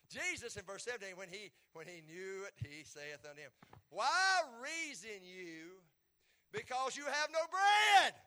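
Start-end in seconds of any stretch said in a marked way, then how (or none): 3.9-8.1 slow, high shout building in force; loud rebuke. Acted. Preacher voicing Jesus questioning the disciples, shouted rebuke. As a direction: demand it, rising to a shout.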